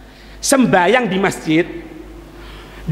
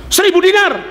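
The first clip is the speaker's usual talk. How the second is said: higher, louder claim; loud accuser; 8 semitones higher, 4 dB louder than usual. Retold or imitated. Accuser telling the Imam the lost sum, louder and higher. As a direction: blurt the sum out.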